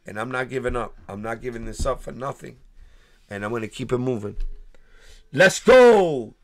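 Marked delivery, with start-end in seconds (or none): 5.3-6.3 loud, high hype call; big excited let's go; hype shout. Real, not acted. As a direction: fire up the live chat. Hyping up live chat.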